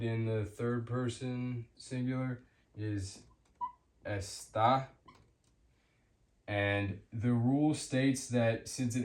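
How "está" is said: "está" as es-TAH; "está" with a stronger, rising end; stress on last syllable. Shown as a demonstration. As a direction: hit the final syllable of "está". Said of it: "that's the syllable that's stressed".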